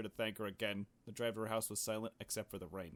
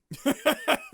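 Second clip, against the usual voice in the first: loud high burst of laughter; 16 dB louder than usual. Real, not acted. Reacting to game.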